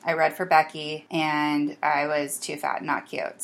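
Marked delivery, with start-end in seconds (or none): none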